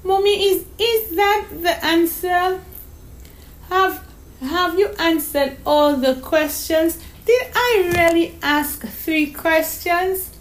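put-on high, halting child's voice; small girl realising she slipped up; dismayed little girl. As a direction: question Mommy in disbelief. Voices young Donna May in story.

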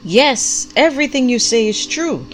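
loud, high, vigorous agreement; vigorous call-out. A narrator's reading. Prophet calls back to the preaching pastor, with vigor. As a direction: call it out across the service. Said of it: "called out with equal vigor".

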